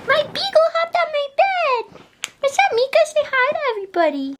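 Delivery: very high cooing voice for the beagle; high sing-song pet voice. Real, not acted. Talking to her beagle.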